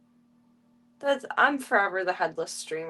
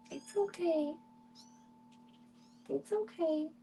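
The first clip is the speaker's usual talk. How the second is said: soft, higher-pitched reassurance; soothing; 6 semitones higher, 9 dB quieter than usual. Real, not acted. Streamer calming the animal mom so she won't get mad, soothing. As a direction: coo gently to an anxious animal.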